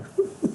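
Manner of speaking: short high burst of laughter. Real, not acted. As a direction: laugh at the joke. Joking about a hypocritical answer. (risada curta e aguda)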